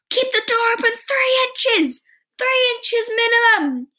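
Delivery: high, loud yell through the door; yelling dad. Retold or imitated. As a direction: bellow the rule at the kids like an angry dad. Hopper yells at kissing teens.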